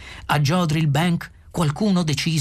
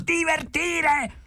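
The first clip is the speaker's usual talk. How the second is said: very high, slow, stretched cry; outraged shriek; much slower than usual. A narrator's reading. Arthur echoes Ford's advice, outraged high shriek. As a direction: shriek the word in outraged disbelief. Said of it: "strillò Arthur".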